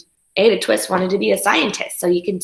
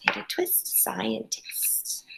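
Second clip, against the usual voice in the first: whisper; 15 dB quieter, slower than usual. Pretend. Teacher whispers title for effect.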